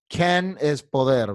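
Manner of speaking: usual speaking voice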